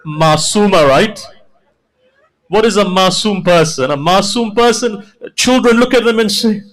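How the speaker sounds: high, loud wailing recitation; preaching at full force. Acted. Preacher voicing Imam's memory of Sakina, full wailing. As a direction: pour out the grief at the top of the voice.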